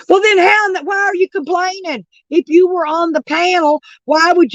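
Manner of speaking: fed-up shout at a contradicting viewer; exasperated shout. Real, not acted. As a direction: yell it in frustration at the chat.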